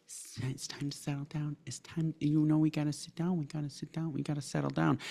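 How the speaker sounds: hushed, overly gentle parent pleading for calm; mocking soft-spoken parent. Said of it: "And they're just going".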